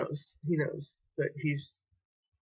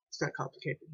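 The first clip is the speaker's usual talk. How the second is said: softer, dropping the repeat away; quiet repeat; 9 dB quieter than usual. Real, not acted. Repeating it to viewers, softly to himself. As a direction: let the echo fall soft to himself.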